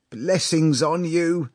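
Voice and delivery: high, loud, slow father's blessing; tearful blessing. A narrator's reading. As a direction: bless them grandly through put-on tears. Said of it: "and he too shed tears".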